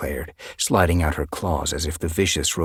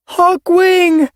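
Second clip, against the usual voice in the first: high, loud joyful call of a name; 12 dB louder than usual. Acted. Cloudmist spotting her brother, calling joyfully. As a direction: call to a long-lost brother. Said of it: "she called".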